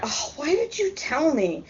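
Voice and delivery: high-pitched mock wail of protest; exasperated, acted-out objection; retold dismayed protest. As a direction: protest the spoiled surprise, pitch jumping up. Retold protest at spoiled surprise.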